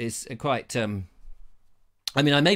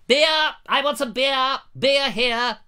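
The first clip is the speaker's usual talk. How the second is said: high-pitched mock demand for a drink; demanding beer; 8 semitones higher, 3 dB louder, slower than usual. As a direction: call out urgently for a beer. Acting out a man demanding beer.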